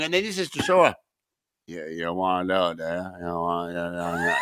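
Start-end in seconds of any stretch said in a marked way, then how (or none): 1.7-4.3 mock him in a low, soft rasp. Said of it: "He sounds like Ma-, he sounds like fucking, um, [name]".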